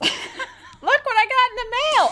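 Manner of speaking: high, loud giddy voice at the mailbox; excited mock voice. Podcast host voicing the killers' delight, high and excited. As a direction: squeal it like a thrilled fool opening a package.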